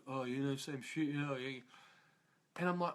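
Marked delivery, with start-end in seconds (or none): 0.1-1.6 low, much softer listless mumble; flat bored mumble. Retold or imitated. Imitating gym guys' bored reply to a greeting. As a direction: mumble it like nothing ever changes. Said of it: "I said to some people".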